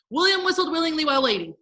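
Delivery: high, rapid-fire recitation; fast tongue twister. Shown as a demonstration. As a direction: race through the twister in one breath. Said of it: "let's try to say that together really fast".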